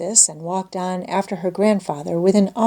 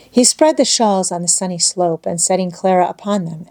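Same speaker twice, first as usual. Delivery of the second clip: ordinary delivery, nothing marked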